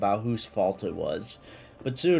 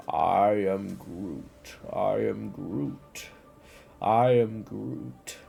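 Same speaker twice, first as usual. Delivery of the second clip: gentle Groot; 2 semitones lower, slower than usual. A narrator's reading. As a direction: soothe them as Groot, drawing each word out. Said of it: "lamented peace and kindness".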